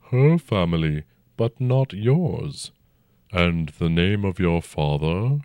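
slow, rumbling ancient dragon. A narrator's reading. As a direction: speak as a huge old dragon, unhurried. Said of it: "the dragon said".

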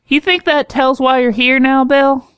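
high, pointed doctor voice; high-pitched questioning woman doctor. Acted. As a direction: ask it high and probing, like a sharp doctor.

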